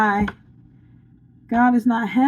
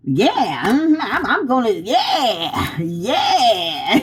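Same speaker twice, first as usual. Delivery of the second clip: drawn-out gloating yeahs with swooping pitch; mock scheming villain; 2 semitones higher, much slower than usual. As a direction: ham up a plotter relishing revenge. Mimicking the scheming evil queen.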